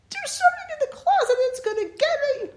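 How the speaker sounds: put-on high, fast, frightened child voice; a terrified kid pleading; little girl to grandmother, panicked; scared little girl.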